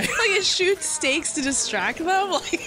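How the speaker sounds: high, giggling through the words; laughing. Real, not acted. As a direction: laugh while you say it. Laughing at weapon idea.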